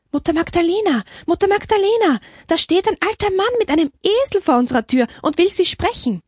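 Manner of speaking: high, urgent, breaking news to the abbess; excited calling. Acted. Sister hurrying to Mother Magdalena about the man at the door, excited calling. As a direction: call out in high-pitched excitement.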